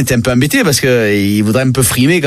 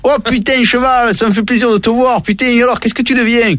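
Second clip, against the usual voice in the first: higher, hearty southern French donkey; southern French accent; 7 semitones higher than usual. Acted. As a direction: greet him with a big southern French "putain". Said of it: "c'est un âne du sud, faut le savoir".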